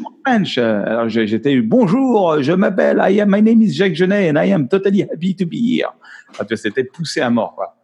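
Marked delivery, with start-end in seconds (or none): 0.0-0.6 repeating the note with a higher push; director insisting, higher. Retold or imitated. Director pushing him for more French accent, higher. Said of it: "Il me disait". 1.6-5.9 exaggerated French accent in English, pitched higher; thick French accent. Director wanted a heavy French accent, hammed-up and higher. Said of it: "il me faisait parler avec un accent français".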